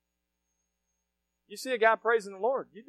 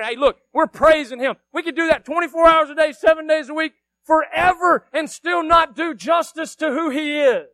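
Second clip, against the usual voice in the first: raised, higher preaching voice; impassioned preaching; 6 semitones higher, 9 dB louder than usual. Real, not acted. Preacher saying praise could never do God justice, impassioned preaching. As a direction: drive it home to the congregation.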